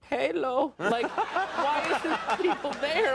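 a hint of a lonely caller; despairing. Palo calling out on the message, mock despairing. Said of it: "he got more despairing".

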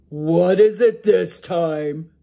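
a sleepy giant ogre grumbling to his wife; loud ogre brushing off his wife's worry. Acted. Ogre impatient.

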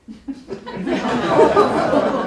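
airy laugh, high; breathy high laugh. Real, not acted. Laughing at his brother's reply, airy high laugh.

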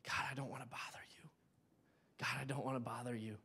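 soft, timid whisper; ashamed whisper. Retold or imitated. A guilty believer whispering a prayer to God. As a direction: afraid to bother anyone. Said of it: "so full of shame and guilt".

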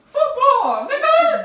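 very high, loud yell; high-pitched excited boast; excited shout. Real, not acted. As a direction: shriek it out with a laugh. Excited boast about friend playing.

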